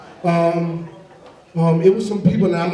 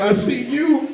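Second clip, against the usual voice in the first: put-on high, playful little boy answer; lightly voiced kid dodging the question, higher; sly kid; 7 semitones higher, slower than usual. Little boy answering his dad at the mirror.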